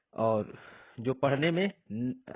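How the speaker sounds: usual speaking voice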